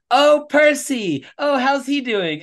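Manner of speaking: high, slow, falsely bright greeting; lightly voiced Trudeau, airy and slow; drawn-out mock-cheery "oh"; mock cheerful Trudeau. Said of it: "He's like".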